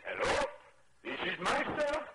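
loud, high Teutonic phone answer; imitated professor's heavy German accent. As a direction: boom it out in a thick German accent. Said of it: "in that heavy Teutonic dialect of his".

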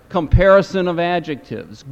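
ordinary talk, nothing marked

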